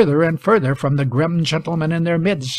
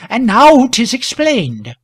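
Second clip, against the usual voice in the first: high, slow, louder woman's voice; faltering woman; 8 semitones higher, 6 dB louder, slower than usual. A narrator's reading. Woman by the wall concludes, faltering. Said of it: "faltered one of the women".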